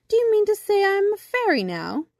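high, loud, incredulous question; amazed exclamation. A narrator's reading. Boy asks bumblebee if he is fairy, amazed. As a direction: ask in high-pitched amazement. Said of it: "exclaimed Birdling".

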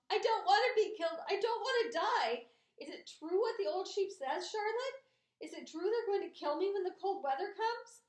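high, loud, frantic pleading; screamed. A narrator's reading. Frantic pig questioning Charlotte about being killed, screamed. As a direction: plead in a high panicked cry. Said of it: "screamed Wilbur, racing up and down".

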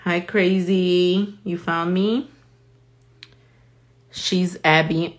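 usual speaking voice

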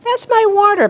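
high indignant protest. A narrator's reading. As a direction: object like a wronged boy. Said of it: "Peter protested".

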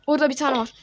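normal talking voice

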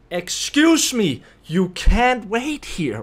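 loud, slow, drawn-out demand; almost yelling. Retold or imitated. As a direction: raise your voice right into their face, word by word. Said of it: "I almost yell at her into her face".

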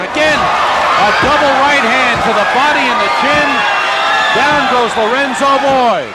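roaring ringside commentary; excited shouted call. Real, not acted. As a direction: belt out the count like ringside. Live knockdown call, shouting.

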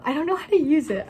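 rushing the words out in glee; giddy excitement. Real, not acted. Vlogger to viewers about her new camera, giddy and fast.

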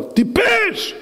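furious high-pitched shout, drawn out; high, stretched-out angry scolding; yelling at him. Acted. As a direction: bawl out a subordinate at the top of your voice. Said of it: "צועק עליו".

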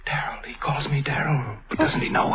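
hushed, hurt inner aside; whispered thought. Acted. Father privately hurt his son calls him Darrell, whispered. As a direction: breathe the question to yourself, wounded.

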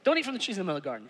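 very fast, soft rattled-off rule; Adam hurriedly repeating his rule to Eve. Retold or imitated. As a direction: rush through it like a careless husband. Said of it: "He didn't tell her which one".

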